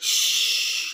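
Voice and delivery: put-on a whispered shh to calm a crying child; hushing.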